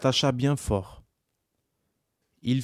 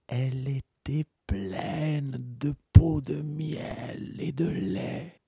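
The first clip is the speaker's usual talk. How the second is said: whisper; slower than usual. A narrator's reading. Narrator drops to whisper.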